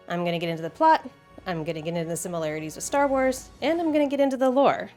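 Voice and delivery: ordinary delivery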